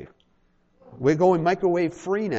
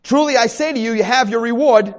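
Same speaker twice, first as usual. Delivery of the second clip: ordinary talk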